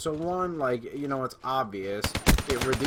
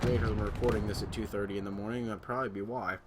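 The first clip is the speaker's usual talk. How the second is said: ordinary talk, nothing marked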